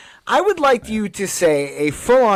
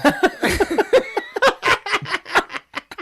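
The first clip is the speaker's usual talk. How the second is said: shrill helpless cackle; real squealing laugh; high squealing laugh. Real, not acted. Star-Lord mix-up laughter.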